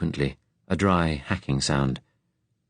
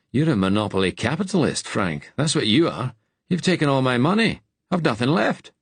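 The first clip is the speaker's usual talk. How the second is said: joking teasing; 7 semitones higher than usual. Acted. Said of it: "Ben said jokingly".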